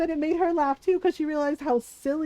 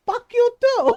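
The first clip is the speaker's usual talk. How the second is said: imitated yell, higher and louder; mom yelling back; 9 semitones higher, 8 dB louder than usual. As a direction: throw it back like an angry mom. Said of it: "She's like".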